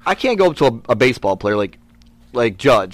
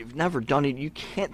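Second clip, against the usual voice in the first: ordinary talk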